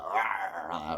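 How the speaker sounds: low, soft mock growl; werewolf growl. Reviewer mimics werewolf snarling at hero, low mock growl. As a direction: grunt it like a snarling wolf. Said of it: "all like".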